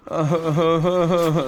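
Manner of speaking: short scornful laugh; mocking laugh. Real, not acted. Streamer laughing at a YouTube troll, scornful.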